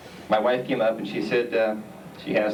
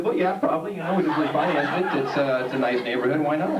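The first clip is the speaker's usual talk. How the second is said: much higher, overly reasonable justification; high defensive reply; 6 semitones higher than usual. Retold or imitated. Husband cheerfully justifying to wife in a high voice. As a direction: pitch it up like you can't see the problem.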